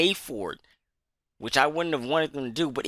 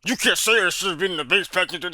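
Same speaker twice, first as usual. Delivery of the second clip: imitating a know-it-all objection, in a different voice; tossed-off mock rebuttal in a put-on voice; mock objector; 3 semitones higher than usual. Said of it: "And you might say".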